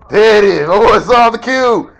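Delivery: loud, hyped-up cheering; excited shout. Real, not acted. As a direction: cheer him on, loud and high. Hyping friend's Rubik's cube play.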